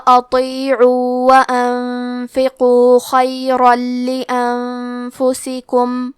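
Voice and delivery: آہستہ، کھنچی ہوئی تجوید والی تلاوت; ہر حرف کو مخرج سے ادا کرتے ہوئے آہستہ تلاوت; قرآن کی تلاوت. Shown as a demonstration. تلاوت کا انداز. (شاگردوں کو سنانے کے لیے آہستہ تجوید سے تلاوت)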